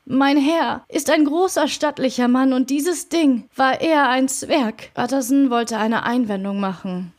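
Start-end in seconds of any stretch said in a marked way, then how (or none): none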